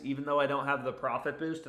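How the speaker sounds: ordinary talk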